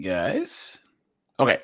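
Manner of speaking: ordinary delivery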